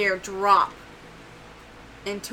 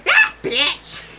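much higher, loud squeaky yell; shrill creature cry; 8 dB louder than usual. Acted. Little Elsie echoing the insult, high squeaky yell. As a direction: screech it like a tiny creature. And you hear Toothy going.

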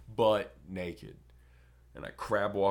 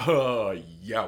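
emphatic; 10 dB louder than usual. Retold or imitated. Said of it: "And he's like".

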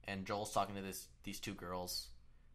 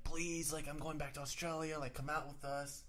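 higher-pitched pleading whine of begging friends; mock begging; 6 semitones higher than usual. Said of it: "they're begging me to go out".

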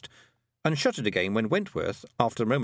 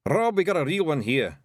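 higher Scottish call; mildly raised pixie voice; 5 semitones higher, 3 dB louder than usual. Acted. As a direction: call your chief over in Scots. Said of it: "a pixie called out".

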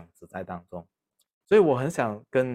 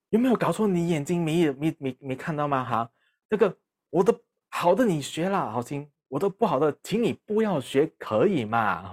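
hammed-up outraged dad laying down the law; angry father; 6 semitones higher than usual. Father yelling at his smoking son, mock outrage.

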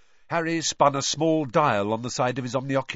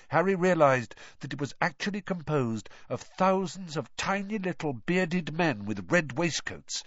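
plain reading voice

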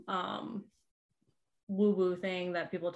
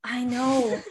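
whispering; 3 semitones higher, 6 dB louder than usual. Real, not acted. Whispered aside.

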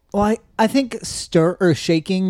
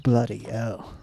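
put-on softer, lower British-accented curse; mock British accent; 5 semitones lower, 7 dB quieter, slower than usual. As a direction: mutter it in fake British. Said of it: "how to do a British accent".

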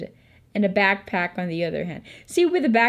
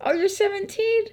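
much higher put-on voice of a sleazy older man; mocking the creepy guy; 12 semitones higher than usual. Teen mocks the old guy claiming nineteen, high mock voice. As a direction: mimic his ridiculous lie in a silly high voice. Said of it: "And this dude fucking said".

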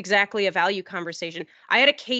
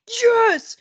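very high, louder cheer; triumphant yes; 14 semitones higher, 7 dB louder than usual. Retold or imitated. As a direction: cheer like you've won. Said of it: "And I was like".

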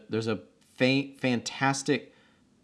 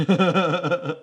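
laughing; 7 semitones higher, 5 dB louder than usual. Real, not acted. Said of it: "laughing echo".

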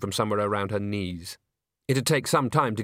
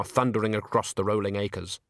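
usual speaking voice

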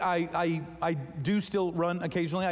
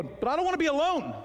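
mock whine; 11 semitones higher than usual. Pretend whine to God.

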